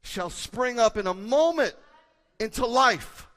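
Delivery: impassioned crescendo; rising preaching climax. Acted. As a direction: drive the sermon to its peak. Sermon climax building.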